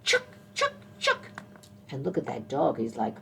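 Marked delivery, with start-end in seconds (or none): 0.0-1.3 high, sharp squirrel chucks; squirrel chatter. A narrator's reading. Teacher showing children what mama squirrel says, high squirrel chatter. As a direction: squeak it out in quick separate bursts. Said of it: "what is she saying?".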